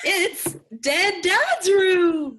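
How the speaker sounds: loud, high, drawn-out prize reveal; hammed-up studio hype; mock game-show host. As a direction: shout it slowly like a jackpot announcement. Mock game-show reveal of dead dad's room.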